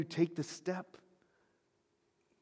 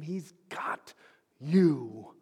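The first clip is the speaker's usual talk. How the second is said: ordinary delivery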